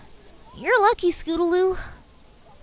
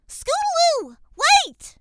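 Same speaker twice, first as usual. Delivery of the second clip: shouted; 6 semitones higher, slower than usual. Acted. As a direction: call out to stop her running off. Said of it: "Apple Bloom shouted".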